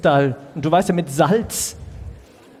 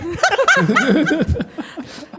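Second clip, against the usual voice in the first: big laugh; 10 semitones higher than usual. Real, not acted. Burst of laughter after joke.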